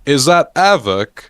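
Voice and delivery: slow, high London accent in imitation of Gunner. Imitating Gunner greeting Avok, in a London accent. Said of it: "tried to imitate the London accent".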